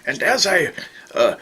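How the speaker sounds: ordinary delivery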